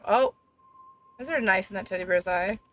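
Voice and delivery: usual speaking voice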